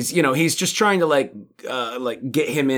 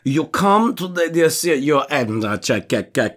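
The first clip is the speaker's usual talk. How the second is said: cartoon Russian villain; 3 semitones higher than usual. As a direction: ham up a Boris Badenov Russian accent. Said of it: "He sounds like a bad guy on Rocky and Bullwinkle".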